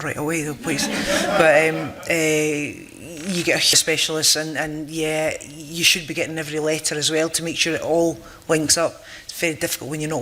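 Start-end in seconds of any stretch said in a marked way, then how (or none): none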